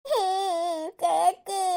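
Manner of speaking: put-on whiny child's crying call. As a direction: wail for big sister like a small child. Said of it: "നീ എന്തിനാ കരയുന്നേ?". (കുട്ടിയെപ്പോലെ കരഞ്ഞുകൊണ്ട്)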